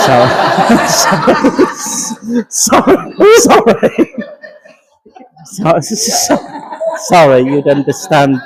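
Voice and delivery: helpless giggling through repeated apologies; can't stop laughing, keep saying sorry; high giggling fit between sorries. Real, not acted. Medium breaks into laughter.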